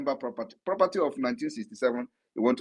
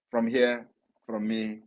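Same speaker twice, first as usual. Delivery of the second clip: usual speaking voice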